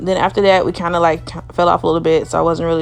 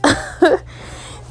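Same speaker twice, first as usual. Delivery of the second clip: quick airy giggle; short unvoiced laugh; breathy laugh; 9 semitones higher than usual. Real, not acted. Laughs mid story.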